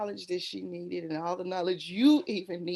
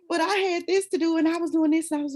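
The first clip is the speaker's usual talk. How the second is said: teacher's excuses; 8 semitones higher, faster than usual. Retold or imitated.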